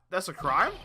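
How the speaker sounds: ordinary talk, nothing marked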